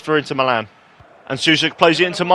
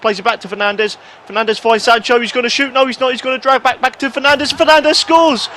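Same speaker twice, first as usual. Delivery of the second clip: horse racing commentator; 7 semitones higher than usual. Real, not acted. Gamer calling his own attack like a horse race, building up. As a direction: build the call from brisk to a yell, like at the track. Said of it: "I sound like a horse racing commentator".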